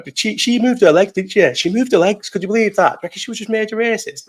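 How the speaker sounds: high-pitched, scandalised disbelief; mock outrage. Mock outrage at woman on train.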